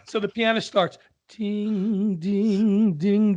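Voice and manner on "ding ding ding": sung "ding" notes, held and higher; ringing mock piano; imitated piano intro. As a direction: mimic a piano's slow opening notes. Imitating the pianist's opening.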